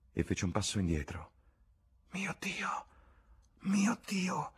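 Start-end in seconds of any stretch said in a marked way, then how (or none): 2.1-4.5 breathless high whisper, mio Dio; whispered exclamation. A narrator's reading. James realising his mistake, shocked whisper. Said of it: "esclamò".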